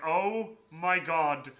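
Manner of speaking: loud, dramatised horror at oneself; retold sinking dismay. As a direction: replay the dismay loudly, tipping into a laugh. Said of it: "my heart just sank".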